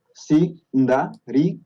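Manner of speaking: "Sindarin" said very slowly, syllable by syllable; drawn out. Shown as a demonstration. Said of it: "digamos, ¿no?". ("Sindarin" muy lento, sílaba por sílaba)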